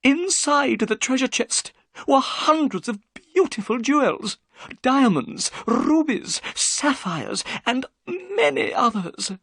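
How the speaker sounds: mildly excited storyteller. A narrator's reading. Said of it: "Thaddeus Sholto's voice became excited".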